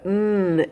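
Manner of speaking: "mm" as a long, held nnn; N sound. Shown as a demonstration. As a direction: hold "mm" as the pure sound of the letter N. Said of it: "N sound".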